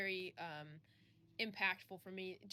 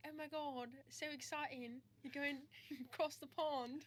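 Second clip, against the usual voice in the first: put-on British accent; 6 semitones higher than usual. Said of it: "they'll just be like".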